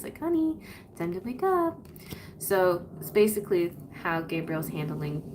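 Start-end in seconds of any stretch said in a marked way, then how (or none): 0.2-1.8 gentle wake-up. Retold or imitated. Archangel gently waking a person, high and tender. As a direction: tenderly rouse a sleeper. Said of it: "this kind of gentle embrace".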